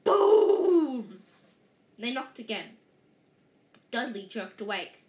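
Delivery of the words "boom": booming knock sound, high and loud. A narrator's reading. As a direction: shout the boom like a blast. Knock on the hut door.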